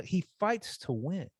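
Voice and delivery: ordinary talk, nothing marked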